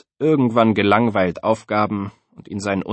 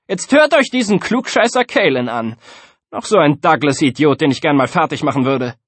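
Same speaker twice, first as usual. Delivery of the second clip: high, loud bully's scorn; mock him in front of the class; sneering schoolkid, starting high and easing down; loud jeering; 6 semitones higher, 5 dB louder than usual. A narrator's reading. Bully jeers at classmate before class.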